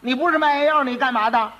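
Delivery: high, sharp challenge; interrogating magistrate. Acted. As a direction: question the suspect with official force. Magistrate snaps back a question.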